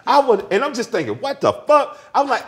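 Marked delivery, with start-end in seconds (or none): 1.2-2.0 high-pitched retold disbelief; shocked disbelief. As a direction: replay your baffled inner reaction. Own shocked thought during the quarrel.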